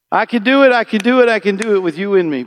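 loud declaration. Real, not acted. Preaching climax, loud declaration.

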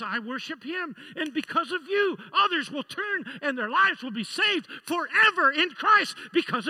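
loud, high, fervent preacher's cry; preaching at full force. Acted. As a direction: pour it out like a revival preacher. Preaching Paul's storm speech.

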